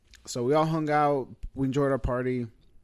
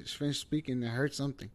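ordinary talk, nothing marked

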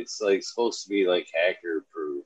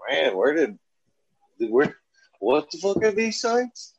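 shocked disbelief; 10 semitones higher, 3 dB louder than usual. Retold or imitated. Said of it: "you'd be like".

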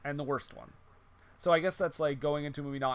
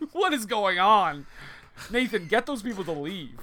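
mock outrage; 5 semitones higher, 7 dB louder than usual. Mock outrage at Ray interruption.

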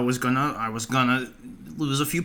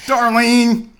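loud, high call of her name; yelling; 11 semitones higher, 8 dB louder than usual. Pretend. Famous actor confronts his rival over a role, yelling. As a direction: holler her name across the room. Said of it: "I'm sorry I yelled".

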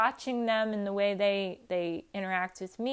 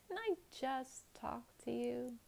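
hushed, higher puzzled question; confused; 4 semitones higher, 10 dB quieter than usual. Acted. To the returning Tupperware lady, quietly confused. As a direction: ask it softly, thrown and puzzled. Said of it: "And now you're confused".

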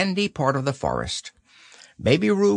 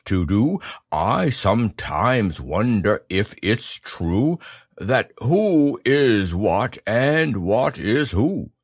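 very slow, careful recitation in Pooh's bear voice; slow bear recital; 2 semitones lower, slower than usual. Acted. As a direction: recite each line slowly like a proud little bear. Pooh recites his poem.

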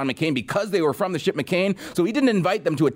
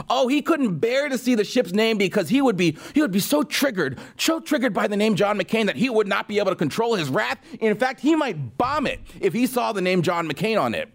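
mock outrage; 6 semitones higher than usual. Mocking imagined Trump rage.

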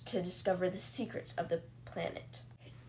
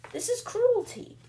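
much higher, louder indignant cry; outraged cry; 5 dB louder than usual. Retold or imitated. Prisoner protesting life aboard to the captain, outraged high cry. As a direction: wail the accusation in outrage.

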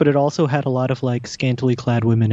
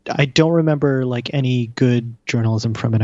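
ordinary talk

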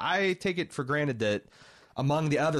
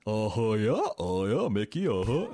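lazy Southern uh-huhs, played for laughs; goofy Nick Cage Southern accent; 2 semitones lower than usual. Host imitating Nick Cage's awful Southern accent, goofy and mocking. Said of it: "He's doing goofy".

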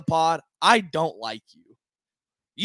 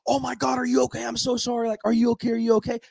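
higher, panicky mock apology; frantic apology; 7 semitones higher than usual. Imitating a friend apologizing after kicking a fan, high and frantic. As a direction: plead sorry over and over. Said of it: "So he's like".

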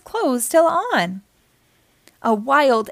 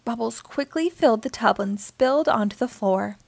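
plain reading voice

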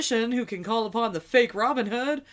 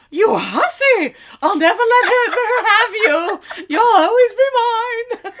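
jealous outburst; 10 semitones higher, 9 dB louder than usual. Retold or imitated. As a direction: scream possessively at the rival. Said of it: "Tree's like".

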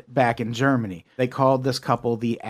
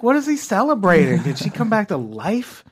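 higher, incredulous questions; baffled disbelief; 5 semitones higher than usual. Retold or imitated. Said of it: "I'm like".

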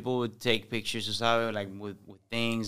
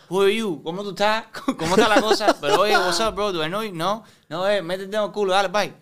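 high, loud, laughing mock exchange; laughing disbelief; 9 semitones higher, 8 dB louder than usual. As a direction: play both sides with a laugh. Acted exchange with fan.

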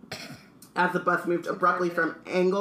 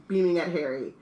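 usual speaking voice

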